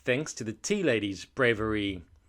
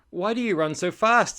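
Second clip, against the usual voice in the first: higher, louder storybook question; puzzled calling out; 8 semitones higher, 7 dB louder than usual. Acted. Terry asks Andy why he runs, puzzled call. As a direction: call after a running friend.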